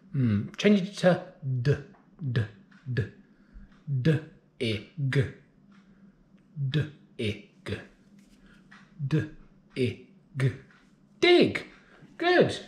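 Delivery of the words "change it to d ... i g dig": d, d, d repeated, then D, I, g blended to Dig; sounding out words. Shown as a demonstration. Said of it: "change that first sound".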